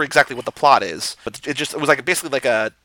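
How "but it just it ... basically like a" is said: normal talking voice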